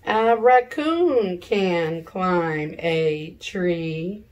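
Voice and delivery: normal talking voice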